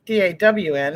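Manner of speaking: ordinary talk, nothing marked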